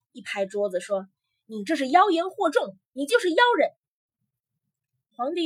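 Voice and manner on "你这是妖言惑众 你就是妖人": loud, high-pitched accusation; angry. A narrator's reading. Emperor banging the table at the accused, angry. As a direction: denounce him in a raised, angry voice. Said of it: "皇帝发了火， 一拍桌子说".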